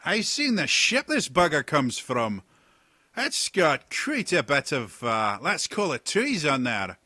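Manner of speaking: loud, high, slow hearty sea-dog; rough pirate captain. Acted. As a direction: boom out like a jovial old pirate. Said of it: "another voice".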